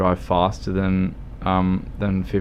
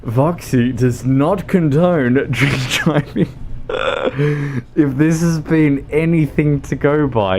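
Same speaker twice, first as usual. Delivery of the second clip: laughing disclaimer; 6 semitones higher than usual. Real, not acted. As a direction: deliver an official disclaimer while cracking up. Mock disclaimer while cracking up.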